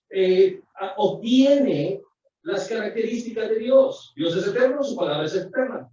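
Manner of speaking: ordinary talk, nothing marked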